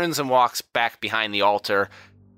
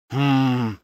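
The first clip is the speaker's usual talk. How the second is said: pondering servant, pitched up; bailiff Harry's voice; 4 semitones higher, slower than usual. Acted. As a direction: mull it over, then advise your lord. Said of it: "puts his finger to his lips and goes".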